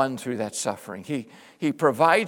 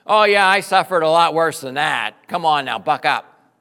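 louder, brushing-off tough-love lecture; imitating a Jesus who scolds the hurting, loud and dismissive; dismissive pep talk; 2 semitones higher, 9 dB louder than usual. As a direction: tell them to toughen up, impatient. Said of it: "some speech about".

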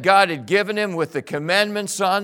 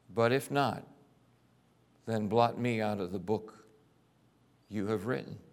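quiet plea; 8 semitones lower, 7 dB quieter than usual. Acted. Moses' plea repeated.

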